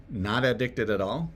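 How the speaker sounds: ordinary talk, nothing marked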